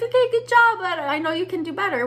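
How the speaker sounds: high, loud mock parent voice, praising but pushing; imitating parents. Parents answering an A minus, in a high, loud imitating voice. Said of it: "my parents would be like".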